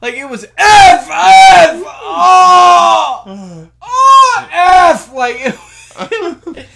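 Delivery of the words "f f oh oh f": high, very loud mock swearing in pain; screamed cursing imitation. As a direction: howl out censored curses. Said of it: "he started cursing".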